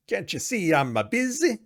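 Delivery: loud, high mock-Italian voice; hammed-up Italian-accented officer snapping at someone; heavy Italian accent. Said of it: "In his heavy Italian accent".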